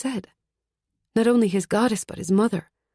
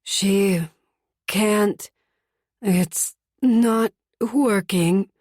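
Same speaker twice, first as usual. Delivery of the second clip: wheezing ghost; much slower than usual. A narrator's reading.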